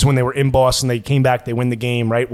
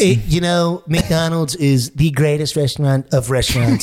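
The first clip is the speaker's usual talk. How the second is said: Donald Trump. Acted. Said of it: "I got Trump and Fat Bastard".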